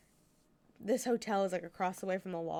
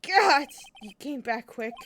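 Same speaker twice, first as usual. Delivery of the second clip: loud, slow startled exclamation, higher; 4 semitones higher, 12 dB louder, slower than usual. Real, not acted. Startled when the bellboy comes back quickly, loud. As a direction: jump at his return.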